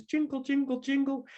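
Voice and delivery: high, monotone sing-song jingle; imitated jingling bells. Imitating bells on a neighbour's skirt, high jingling chant. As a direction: chime it high like little bells. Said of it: "bells sewn into her skirt".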